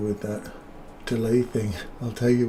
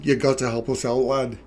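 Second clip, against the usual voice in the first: hammed-up Scottish-English-Canadian mix; playful Scottish brogue; mock Scottish accent. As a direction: put on a muddled Scottish accent. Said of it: "The Scottish, English, Canadian".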